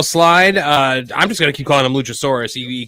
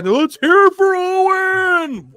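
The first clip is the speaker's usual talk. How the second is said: Tony Khan impression; 13 semitones higher, much slower than usual. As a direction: belt it out like a ring announcer. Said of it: "he dragged her".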